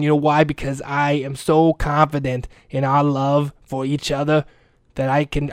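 ordinary talk

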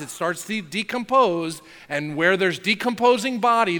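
usual speaking voice

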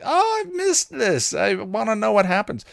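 mock gush of a fan who missed it; eager. Host imitating herself missing the readings, high and gushing. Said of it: "I'm like".

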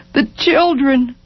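slow, high grief; broken. A narrator's reading. Wife collapsing in husband's arms, broken. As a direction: draw the words out brokenly. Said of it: "Over and over, broken".

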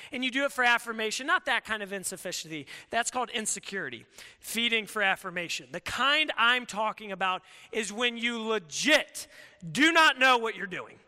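ordinary delivery

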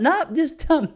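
put-on high, sweet old-lady voice; old auntie voice. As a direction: play the cheerfully oblivious aunt. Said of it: "She's like".

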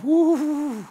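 high, loud jittery exhale of nerves; shaky-nerved whoop at the drop; nervous woo. Retold or imitated. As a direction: psych yourself up, half scared. Nervous at the cliff edge.

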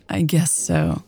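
small teary giggle before speaking; giggling. A narrator's reading. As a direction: giggle lightly, then concede. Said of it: "Lex giggled".

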